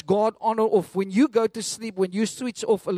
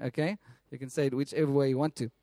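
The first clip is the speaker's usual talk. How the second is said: ordinary delivery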